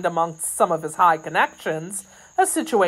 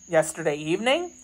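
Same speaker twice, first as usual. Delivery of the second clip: questioning man; 3 dB quieter, faster than usual. Acted. D'Artagnan checking Kitty's news, questioning. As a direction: echo it quickly in an everyday pitch, questioning. Narrator voices D'Artagnan repeating it.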